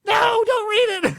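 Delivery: high loud wailing cry, slow; hammed-up sobbing shout; mock crying plea. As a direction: wail it like a desperate plea. Imitating suspect begging, crying shout.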